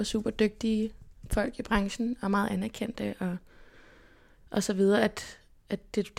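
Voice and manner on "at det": ordinary delivery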